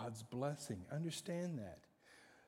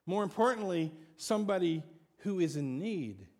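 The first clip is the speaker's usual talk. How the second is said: loud, higher preaching; full-force preaching; 9 semitones higher, 10 dB louder than usual. Acted. Preacher drives home the blessing to the congregation, loud and high. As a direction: preach it with fire.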